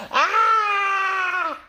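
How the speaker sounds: high startled scream; panicked scream. Acted. Elephant shrieks at the bird on his head, high and panicked.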